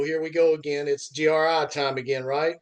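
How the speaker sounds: ordinary talk, nothing marked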